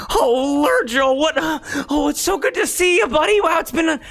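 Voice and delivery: put-on high, tight, jittery young voice; nervous high voice. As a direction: squeak it out nervously. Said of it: "That's my nervous voice".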